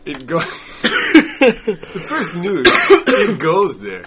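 slow, loud laughing drawl. Real, not acted. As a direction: laugh through the words.

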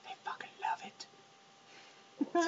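slow whispered swear; hushed, drawn-out cuss; bleeped out. Real, not acted. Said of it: "now I have to bleep that out".

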